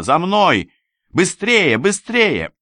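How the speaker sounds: high, loud hurrying cries; urgent shouting. A narrator's reading. Rescuer dragging the narrator down the corridor, urgent shout. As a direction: shout to hurry him along. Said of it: "крича".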